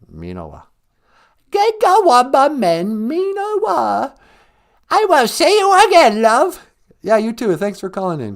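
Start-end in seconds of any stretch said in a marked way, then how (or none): 1.5-4.2 high, drawn-out comic British granny; high, slow royal attempt at Ojibwe; Queen. 4.9-6.7 high comic British granny farewell; put-on old monarch signing off; high-pitched hammed-up royal goodbye; Queen.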